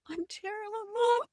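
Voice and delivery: tiny, very high, soft whimper; mock wail. Actor phoning mother about being terrible, mock whimper. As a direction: whine it small and squeaky, hammed-up.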